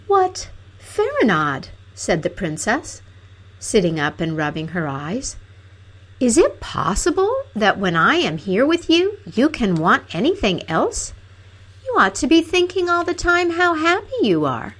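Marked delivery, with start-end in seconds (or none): 0.1-1.7 much higher, drawn-out princess voice; slow, high-pitched disbelief; princess, taken aback. A narrator's reading. Princess just woken by Fanfaronade, taken aback. 6.2-14.7 high-pitched, wounded princess voice; reproachful princess. A narrator's reading. Princess chiding her hungry companion, reproachfully.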